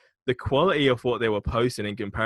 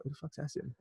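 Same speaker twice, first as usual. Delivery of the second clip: very soft, higher-pitched puzzled question; hushed retort; 4 semitones higher, 14 dB quieter than usual. Retold or imitated. Boss asking the gatekeeper about an unknown caller, hushed. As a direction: imitate a muted reply off the phone.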